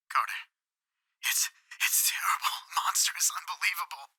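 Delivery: hushed, horrified whisper; frenzied whisper. A narrator's reading. Warren on the phone from below, a horrified hushed whisper. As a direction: gasp it out in dread. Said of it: "Then came the frenzied tones again".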